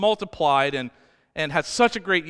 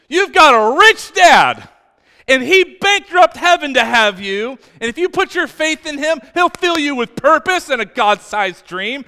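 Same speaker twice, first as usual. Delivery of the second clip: loud, high, fervent preaching; impassioned pulpit preaching; full-force preaching; 5 semitones higher, 9 dB louder than usual. Acted. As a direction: preach it out to the back row. Preaching loud about God's kindness.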